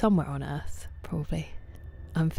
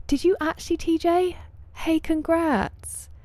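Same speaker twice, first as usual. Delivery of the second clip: high, bright squeal of congratulations, stretched out; excited congrats; 12 semitones higher, slower than usual. Real, not acted. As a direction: gush over a viewer's big news. Congratulating viewer on Twitch affiliate.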